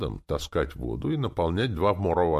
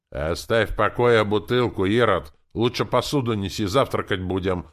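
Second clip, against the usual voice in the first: scolding housewife, louder and higher; raised voice; 6 semitones higher, 6 dB louder than usual. A narrator's reading. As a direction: raise your voice to order him about. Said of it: "повысила голос хозяйка". (повышенным голосом, ворчливо командуя)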